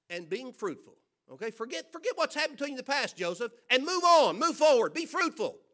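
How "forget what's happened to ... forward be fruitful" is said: builds to a loud, higher-pitched preaching cry; rising preaching shout. Acted. Preacher urging the church to move on, loud climax. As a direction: push it up into a full-voiced exhortation.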